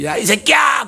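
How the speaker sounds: high, furious yell at a preacher; imitated angry passenger; angry heckler shout. Passenger curses the preaching pastor.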